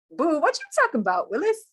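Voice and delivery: high-pitched, hammed-up disbelief; confused students. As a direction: play a sassy catchphrase of bafflement. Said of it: "the students are kinda like".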